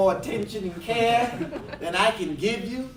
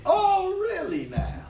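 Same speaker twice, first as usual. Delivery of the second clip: mock surprised minister; 11 semitones higher than usual. Minister answering couple, amused.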